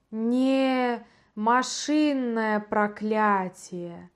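very slow, eerie alien voice; otherworldly creature voice. Acted. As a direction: intone it like something inhuman. The creature answers.

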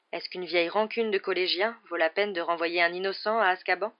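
plain reading voice

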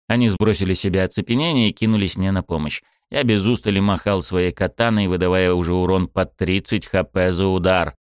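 ordinary delivery, nothing marked

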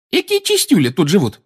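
put-on high, loud young witch exclaiming; lively exclamation. A narrator's reading. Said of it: "воскликнула Тонкс".

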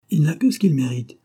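high, loud, unsparing reply; pitiless retort. A narrator's reading. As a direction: retort without pity. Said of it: "impitoyable et injuste".

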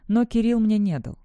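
usual speaking voice

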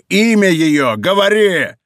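loud, high, insistent demand; требование. A narrator's reading. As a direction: shout as if tearing out the answer. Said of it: "как будто желая вырвать из него". (яростно требуя)

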